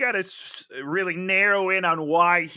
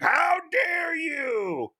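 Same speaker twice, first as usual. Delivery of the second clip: high, very slow crying shout; mock offended husband; hammed-up outrage; 11 semitones higher, much slower than usual. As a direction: wail it like a melodramatic victim. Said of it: "He's like".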